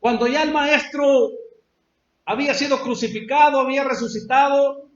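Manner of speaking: loud, high sermon delivery; fervent preacher; preaching at full force. Acted. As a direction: drive the story home from the pulpit.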